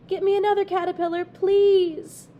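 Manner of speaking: fast, high, loud eager shout; excited call. A narrator's reading. Excited boy guarding bucket.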